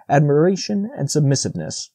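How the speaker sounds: usual speaking voice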